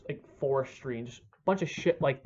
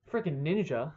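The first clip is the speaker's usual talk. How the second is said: ordinary talk, nothing marked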